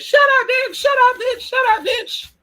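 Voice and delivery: very high, loud bird screech; shrieking bird. Retold or imitated. Bird yelling at the talking friend, very high loud screech. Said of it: "that bird said".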